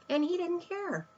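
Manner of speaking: usual speaking voice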